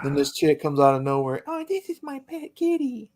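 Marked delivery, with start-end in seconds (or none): 1.5-3.1 higher, sing-song imitation of a doting owner; mock girly voice. Mocking a woman who calls her puma her pet kitty, cooing.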